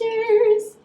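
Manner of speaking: high bright cheers; cheerful toast. Real, not acted. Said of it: "Let's get a little cheers".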